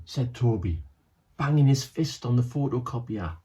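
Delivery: plain reading voice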